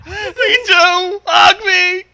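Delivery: high, loud whimpering cry; cling on in mock panic; overacted frightened cry; hammed-up terror. Hammed-up fear.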